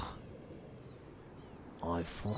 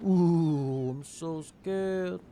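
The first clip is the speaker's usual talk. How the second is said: high, drawn-out fake fright; mock scared; 6 semitones higher, much slower than usual. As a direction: stretch out a sarcastic "so scared". Mocking a threat in game.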